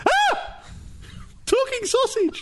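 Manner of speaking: very high, slow squeal with laughter; squeaky egg voice. Acted. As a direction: shriek it like a cartoon egg. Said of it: "And the egg goes".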